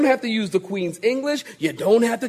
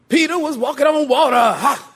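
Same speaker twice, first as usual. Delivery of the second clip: high, punched pulpit shout ending in "Ha!"; mock preaching voice; 4 semitones higher, 4 dB louder than usual. Preacher jokingly retells Peter's story in the mocked pulpit style. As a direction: slip playfully into revival-preacher cadence. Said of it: "I'm gonna start preaching that way".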